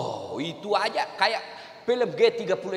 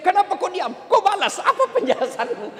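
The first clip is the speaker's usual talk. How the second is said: higher, louder mock scolding with a laugh; mock nagging wife; 12 semitones higher, 6 dB louder than usual. Preacher imitating a nagging wife demanding answers, mock scolding. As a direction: nag like an annoyed wife, half laughing. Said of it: "juga ibu kalau ngomel".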